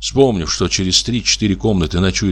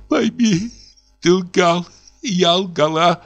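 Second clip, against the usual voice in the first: much higher, slow, tearful; sobbing confession; 10 semitones higher, slower than usual. Acted. Wife tearfully accusing husband, much higher sobbing. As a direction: confess through sobs.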